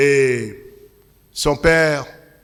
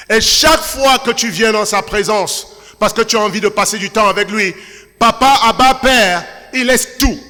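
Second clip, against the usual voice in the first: loud, ringing sermon delivery; full-force preaching; 5 semitones higher, 5 dB louder than usual. Acted. Pastor exhorting the congregation, in full preaching voice. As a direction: preach it out to the whole church.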